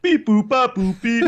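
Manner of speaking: high, loud beeping robot. Retold or imitated. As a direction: beep it out like a machine. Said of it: "Calculations say".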